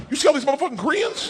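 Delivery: high, fast incredulous woman; Sandy's voice. Retold or imitated. Sandy greeting him in the corner, high and fast incredulous. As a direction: blurt it out in disbelief.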